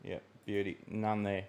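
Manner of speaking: soft, absent murmur while checking; talking to himself. Real, not acted. Streamer finds no super chats, murmuring to himself. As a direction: say it low, just for yourself.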